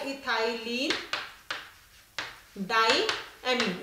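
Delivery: the name ethylene diamine drawn out slowly, syllable by syllable; slowly spelling out the name for the class; slow naming. Shown as a demonstration. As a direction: dictate ethylene diamine slowly so students can write it. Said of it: "इसका जो नाम है".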